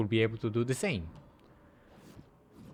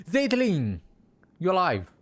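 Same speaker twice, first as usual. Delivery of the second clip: high calling voice; 6 semitones higher, 4 dB louder than usual. Acted. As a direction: call out across the room. Calling out to found friend.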